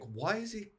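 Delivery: ordinary delivery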